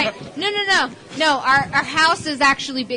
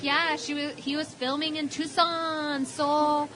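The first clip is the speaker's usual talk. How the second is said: put-on lightly accented grandma, slow and offhand; nonchalant grandma; 4 semitones higher, 5 dB quieter, slower than usual. Grandma shrugging off her Shatner fling, nonchalant imitation. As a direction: imitate a breezy old lady, words stretched out. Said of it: "she was nonchalant about it".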